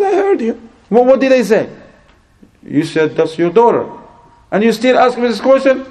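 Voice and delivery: high-pitched, exasperated challenge; acted indignation. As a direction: play an offended father demanding answers. Angry father challenged in retold quarrel.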